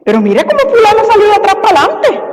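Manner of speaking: imitando a una chismosa, más aguda y rápida. Retold or imitated. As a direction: cuchicheo de chisme exagerado, agudo y veloz. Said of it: "No recurrir primero al chisme". (imitación ligera de chismosa)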